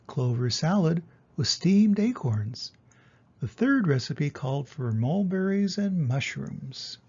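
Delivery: usual speaking voice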